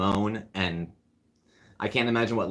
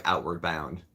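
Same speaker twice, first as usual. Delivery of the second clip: normal talking voice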